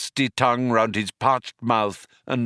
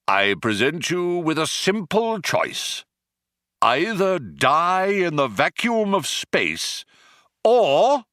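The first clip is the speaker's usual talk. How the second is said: higher, grand villain delivery; menacing Vogon; 9 semitones higher than usual. Acted. The Vogon gives his prisoners a cruel choice, grandly. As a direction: savour the threat.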